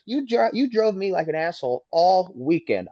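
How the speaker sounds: heated accusation. Retold or imitated. Driver accusing rival after the wreck, heated. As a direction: lay into him, stressing the grievance. Retold quarrel after the crash.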